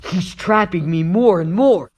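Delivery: ordinary talk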